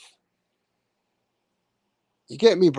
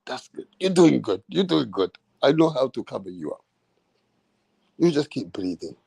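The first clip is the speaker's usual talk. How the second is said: soothing referee; 5 semitones lower than usual. Retold or imitated. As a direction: coax him gently to breathe. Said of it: "the ref is like".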